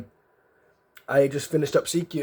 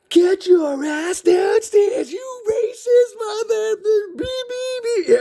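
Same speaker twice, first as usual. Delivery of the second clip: slow, very high, louder screaming imitation of a sergeant; enraged screaming; 4 dB louder, much slower than usual. Furious drill sergeant orders recruit downstairs, screamed high. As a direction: shriek the order, drawing the words out.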